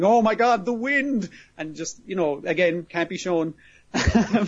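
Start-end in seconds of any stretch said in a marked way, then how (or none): 0.0-1.3 high, half-held imitation of panicked screaming; hammed-up cry of alarm; mock scream. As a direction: retell a terrified cry without fully screaming. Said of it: "people just screaming".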